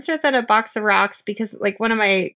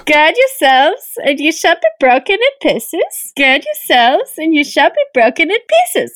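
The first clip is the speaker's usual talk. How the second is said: higher, lilting fake German-Swedish accent; muddled German-Swedish; 4 semitones higher than usual. Co-host reading repetitive scripture, sing-song German-Swedish accent. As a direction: bounce through it in a botched German-Swedish put-on. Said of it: "German, Sweden, they're all the fucking same".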